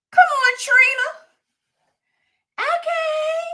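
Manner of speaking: high, loud squeal of delight; high excited cheer. Real, not acted.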